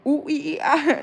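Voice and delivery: high squeaky flustered noises; mock helpless squeal of confusion; confused whimpering noises. Retelling math class confusion, mock squeal.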